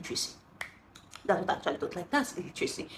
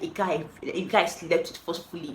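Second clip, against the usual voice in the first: ordinary talk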